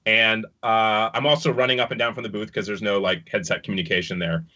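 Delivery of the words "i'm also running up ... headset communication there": usual speaking voice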